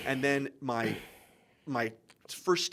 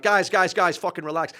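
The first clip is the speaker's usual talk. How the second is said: higher, louder plea to break it up; urgent interjection; 4 semitones higher, 7 dB louder than usual. Retold or imitated. Stepping in to break up a fight, urgent. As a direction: step in quickly over the argument. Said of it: "gone in and been like".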